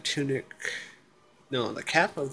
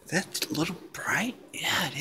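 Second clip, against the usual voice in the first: hushed whispered aside; whispering; 4 semitones higher, 6 dB quieter than usual. Real, not acted. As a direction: whisper it to yourself. Quiet aside about screen brightness.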